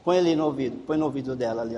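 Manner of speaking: normal talking voice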